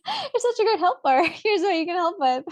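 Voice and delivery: high, sing-song praise with laughter; laughing mock praise. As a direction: play the gushing parent, amused. Playfully echoes praise to tattler.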